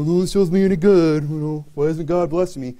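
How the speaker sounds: mock whine of a pouting child; hammed-up self-pity in a put-on voice; sulking thumb-sucker. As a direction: whine as if sucking your thumb. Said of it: "over in the corner sucking our thumb".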